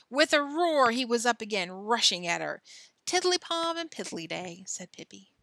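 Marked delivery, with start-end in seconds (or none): 3.0-4.6 high singsong nonsense; playful teasing. A narrator's reading. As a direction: toss it off lightly, pitched up. Pippi playfully teases the strongman.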